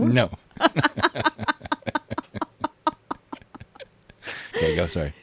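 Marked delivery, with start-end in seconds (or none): 0.3-3.8 long high laugh. Real, not acted.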